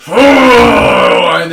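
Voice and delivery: high loud fearful cry; frightened cry. Acted. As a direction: cry out in fear. Captives shrink in fear.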